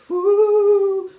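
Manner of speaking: high, loud ghostly ooh; spooky ooh. Pretend. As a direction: spook the kids. Introducing haunted trousers.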